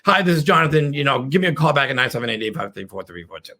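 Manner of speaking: fast, trailing off into a mumble; rushed voicemail. Shown as a demonstration. Caller rushing a voicemail, fast and fading. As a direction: rattle it off and fade. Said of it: "So they talk really fast".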